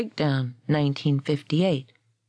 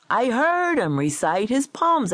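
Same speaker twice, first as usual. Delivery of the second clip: higher, emphatic insistence; argumentative, raised-pitch claim; insistent protest; 7 semitones higher than usual. A narrator's reading. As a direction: insist on having been there. Gold insisting, against Parsons' denial.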